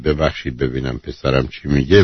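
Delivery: kind. Shown as a demonstration. Host showing a caller how to answer her son kindly. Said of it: "و باش مهربون صحبت کنید".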